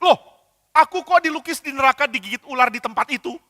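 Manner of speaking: high, loud and appalled; he can't believe what he sees; shocked indignation. Acted. Church leader seeing his own face painted in hell, shocked and loud.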